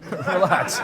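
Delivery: teasing "Relax" through a laugh. Retold or imitated. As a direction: chuckle as you tell him to calm down. Friends telling him to calm down.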